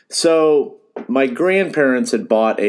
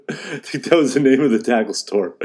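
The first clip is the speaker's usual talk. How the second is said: laughing; 4 semitones higher than usual. Real, not acted. Laughing at the store's name.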